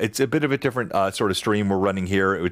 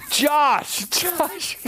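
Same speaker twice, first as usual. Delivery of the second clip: high, giggling protest, pleading through laughter; real laughing protest at a teasing player; 13 semitones higher, slower than usual. Real, not acted. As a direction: laugh and beg him to stop. Laughing as a player teases him.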